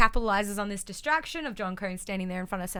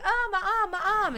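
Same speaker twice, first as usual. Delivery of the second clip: high-pitched mock whine of pain; hammed-up cry over a sore arm; mock pained wail; 12 semitones higher than usual. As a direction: whine it high, imitating someone hurt. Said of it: "and she was like".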